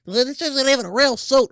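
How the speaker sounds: put-on high, bratty kid voice; a fifth grader mocking the costume; snotty kid voice. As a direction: sneer like a smug fifth grader.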